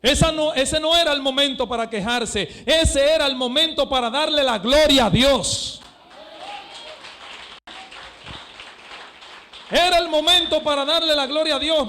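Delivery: loud, high shouted proclamation; preaching at full force. Acted. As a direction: shout the glory to God from the pulpit. Preaches climax. (predicación a gritos, fuerte y aguda)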